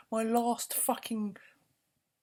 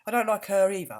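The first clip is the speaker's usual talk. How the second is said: usual speaking voice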